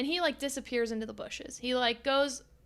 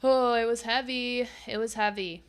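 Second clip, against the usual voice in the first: ordinary talk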